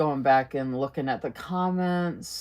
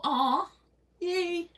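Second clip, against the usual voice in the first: delighted aw; 12 semitones higher than usual. Real, not acted. Touched by a viewer's kind comment.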